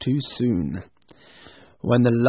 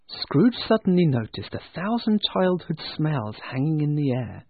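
plain reading voice

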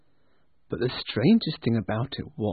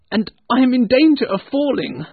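put-on higher, louder protest of a frightened old man; arguing; 11 semitones higher, 8 dB louder than usual. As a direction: argue back in alarm. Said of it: "Scrooge argued".

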